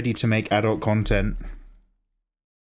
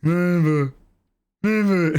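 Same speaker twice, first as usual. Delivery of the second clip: evil wizard; 6 semitones higher, slower than usual. Retold or imitated. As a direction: fling each never out in defiance. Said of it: "And he just goes".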